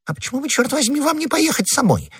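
very high, squeaky protest; flustered, indignant falsetto; exasperated retort. Acted. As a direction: throw the question back, voice shooting up. Husband snaps back at wife.

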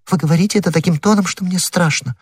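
frightened. A narrator's reading. Frightened woman voiced.

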